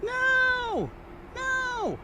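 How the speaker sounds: very high yelped no; a squeaky high cry of no; hammed-up high pitched no; mock yell. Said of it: "I was busy yelling".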